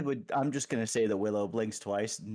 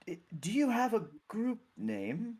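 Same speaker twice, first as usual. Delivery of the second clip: higher, halting cleric voice; tentative, awkward question in a raised voice; puzzled clergy member; 7 semitones higher than usual. Acted. Clergy member asks the group's name.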